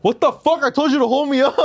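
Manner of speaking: man at mall yelling at girlfriend, mock rage; mock angry yell. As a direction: blow up at his girlfriend, half laughing. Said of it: "and he goes".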